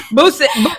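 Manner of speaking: high, laughing stumble on "Vuce-, Vu-"; Kendrick Perkins. Retold or imitated. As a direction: mimic the botched "Vuce-, Vu-" while cracking up. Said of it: "Kendrick Perkins trying to say this name".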